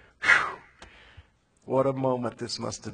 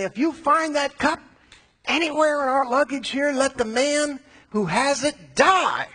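raised high and loud, slowing on the last words; loud indignant oath; 12 semitones higher, 6 dB louder than usual. Acted. Brothers swearing to the servant, loud and indignant. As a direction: swear it loudly, sure you're innocent.

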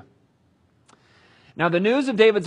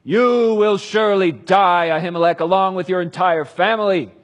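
furious sentence; 4 semitones higher, 9 dB louder than usual. Acted. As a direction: bellow the death sentence. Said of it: "the king shouted".

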